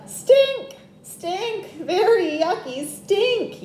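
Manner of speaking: high, loud yell of stink. A narrator's reading. Little brother yells again about the skunk. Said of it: "yelled Tate".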